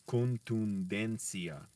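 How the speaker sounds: contundencia drawn out syllable by syllable; con-tun-den-cia, half speed; slow careful. Shown as a demonstration. Learner drilling, saying contundencia very slowly. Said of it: "That's why I have to say it slowly".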